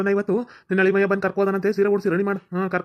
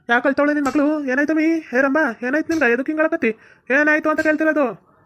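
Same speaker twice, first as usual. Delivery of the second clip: high, raised voice demanding answers; father's higher pitched raised concern; alarmed questioning; 6 semitones higher than usual. Acted. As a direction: call out, worried why she cries. Father alarmed at daughter crying.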